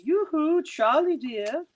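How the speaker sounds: high, slow sing-song call; tinkly pretty voice. A narrator's reading. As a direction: coo it sweetly like a teasing mermaid. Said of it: "tinkly and clear, a pretty little voice says".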